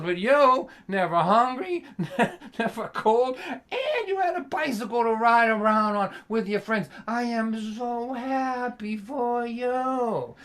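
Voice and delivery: high, drawn-out mock joy, slow on so happy; dripping sarcasm. Dad dripping sarcasm at his spoiled son. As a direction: smother him in fake happiness. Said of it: "dripping with sarcasm".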